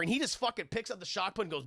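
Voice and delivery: ordinary delivery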